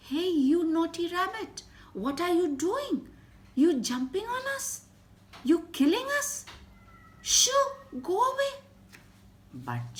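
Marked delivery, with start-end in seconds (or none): 0.1-8.5 high, loud scolding queen ant; angry queen ant. Acted. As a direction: shoo the rabbit away in a shrill rage. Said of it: "and she said".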